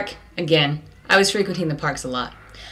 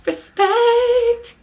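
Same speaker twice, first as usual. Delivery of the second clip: very high, strained cry of Respect; exclamation of respect. Acted. Vlogger saluting Puerto Rican parents, very high call. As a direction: fling it out as a big salute.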